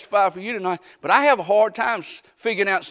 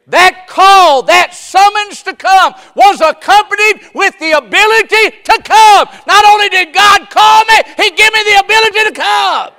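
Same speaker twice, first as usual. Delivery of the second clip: high, shouted preaching cry; full-force preaching; 14 semitones higher, 17 dB louder than usual. Acted. Preacher shouting to the congregation, full-force preaching. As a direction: preach it out to the whole church.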